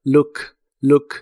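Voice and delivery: "Look" with a short, punchy U and a sharp K; "look" with a clipped oo; short explosive U. Shown as a demonstration. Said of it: "una U corta y explosiva".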